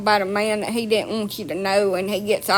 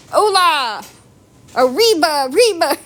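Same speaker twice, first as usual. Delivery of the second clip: higher, louder hammed-up Spanish cheer; mock Spanish cheer; 6 semitones higher, 4 dB louder than usual. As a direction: cheer like a playful little matador. Playing the little matador.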